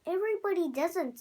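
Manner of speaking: snapped back quickly; angry voice. A narrator's reading. Rhinoceros telling off the father, mock angry and fast. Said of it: "said the angry voice".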